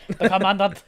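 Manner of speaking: lightly mocking West African accent, commandant stretched; a hint of a put-on West African accent, loud. Friends joking through the movie, lightly mocking West African accent. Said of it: "making fun of the accent".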